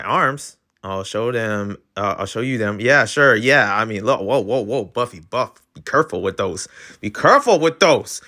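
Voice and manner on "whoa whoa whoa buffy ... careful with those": usual speaking voice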